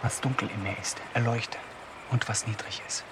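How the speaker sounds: soft, low whispered plea; a hushed prayer to herself in the dark. Acted. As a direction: breathe the prayer out barely voiced. Whispered prayer alone at night.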